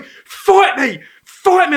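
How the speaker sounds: slow, high, loud mock challenge; hammed-up drunk shouting for a fight; drunk challenger. Drunk bloke demands a fight, hammed-up shout.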